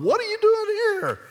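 very high, loud squeal; excited shriek. Acted. Preacher plays Elizabeth greeting visiting Mary, excited high shriek. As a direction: shriek it in hammed-up amazement.

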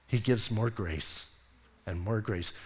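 softly marvelling at grace; hushed and tender. Real, not acted. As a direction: drop to a soft, tender hush. Preacher softens on grace.

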